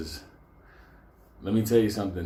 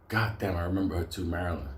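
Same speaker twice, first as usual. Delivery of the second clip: ordinary talk, nothing marked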